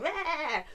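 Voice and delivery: put-on a whiny little "Meh" like a cross two-year-old; hint of a tantrum bleat; imitated toddler whine. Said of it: "end up on the floor going".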